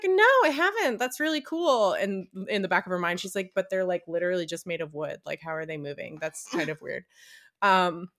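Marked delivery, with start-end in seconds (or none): 0.1-1.9 bright, much higher fake politeness; high chirpy reply. Girl feigning polite interest. 3.5-7.0 softer, quick private musing; quiet inner thought. Retold or imitated. Inner thought, in her mind.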